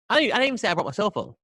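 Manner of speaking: hasty mock-panicked denial; frantic denial. Real, not acted. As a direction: rush to take it all back. Frantic denial about the VPN.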